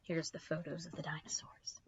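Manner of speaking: hushed whispered aside. Real, not acted. Reader whispering about the book's pictures, hushed whisper. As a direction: whisper it to the listeners.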